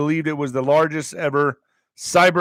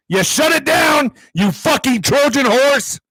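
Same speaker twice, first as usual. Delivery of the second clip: angry shout; 9 semitones higher, 3 dB louder, slower than usual. Real, not acted. Ranting at the president.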